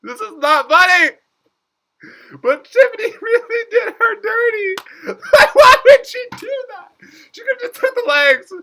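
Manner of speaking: very high, loud shrieks broken by laughter; laughing outrage. Real, not acted. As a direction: protest while cracking up. Laughing outrage at the arm loss.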